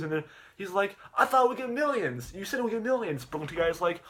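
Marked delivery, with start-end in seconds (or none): 1.2-3.2 high-pitched mock protest; acted complaint. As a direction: protest in a raised voice, playing let down. Acted complaint at low views.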